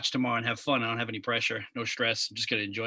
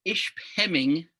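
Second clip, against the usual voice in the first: "Ishpeming" said slowly, syllable by syllable; careful pronunciation; 5 semitones higher, 3 dB louder, much slower than usual. Real, not acted. Host checking a town name: "Ishpeming" slow, careful. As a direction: sound out "Ishpeming" carefully, unsure of it. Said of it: "is that correct?".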